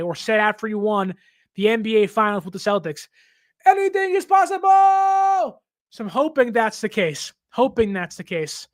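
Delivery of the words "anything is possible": slow, much higher, full-throated shout; Kevin Garnett shout. Retold or imitated. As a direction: bellow it like a champion. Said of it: "like Kevin Garnett used to say".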